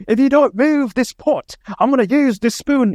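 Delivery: high-pitched mock British accent; Gordon Ramsay. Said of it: "That's my British accent".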